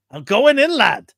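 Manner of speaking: high, loud cartoon accent; Groundskeeper Willie. Groundskeeper needing to get in the vents, Groundskeeper Willie impression. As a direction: announce it boldly in a hammed-up character voice. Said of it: "What, your accent?".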